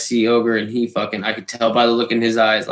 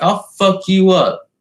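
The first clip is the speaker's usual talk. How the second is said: playful tough-guy threat, pitched up; mock threat; 7 semitones higher, 4 dB louder than usual. Teasing a drunk friend at the party, mock threat. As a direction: jokingly threaten to beat him up.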